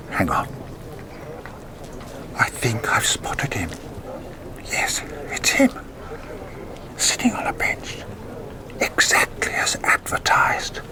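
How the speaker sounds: hushed whisper into a hidden recorder. Acted. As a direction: keep it breathless and secret, barely voiced. Spotting contact, secretly recording.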